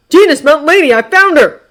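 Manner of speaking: loud, high-pitched urgent shout; calling out. A narrator's reading. Tiger calls to teammates on finding her, calling out. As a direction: call your teammates over. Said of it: "calling to each other from within".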